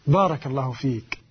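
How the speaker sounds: usual speaking voice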